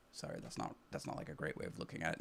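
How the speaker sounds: quick hushed whisper, rushed; whispered aside. Real, not acted. Apologizing to viewers for wording, in a rushed whisper. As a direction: slip it in under your breath, fast.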